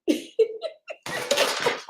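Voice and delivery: high airy burst of laughter; real laugh that runs out of breath; breathless laugh. Real, not acted. Breathless laugh while telling the viewers. Laughing at her own story.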